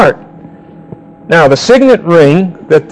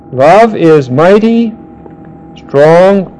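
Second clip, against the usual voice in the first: long pauses between words, each word weighted; slow, weighted reading; slower than usual. Shown as a demonstration. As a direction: spell out "Love is mighty" word by word, slowly. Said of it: "it literally says this".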